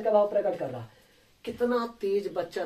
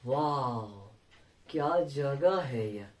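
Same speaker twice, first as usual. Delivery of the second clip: lower, slow, hushed wonder; quiet amazed "वाह"; soft awed wow; 6 semitones lower, 5 dB quieter, much slower than usual. Retold or imitated. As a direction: breathe it out in awe. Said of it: "देखने के बाद आश्चर्य हो गए".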